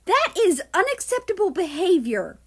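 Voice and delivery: loud, high, stern reprimand; stern scolding. Acted. Nazi reprimands his stepson Russia, loud and stern. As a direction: lay down the law.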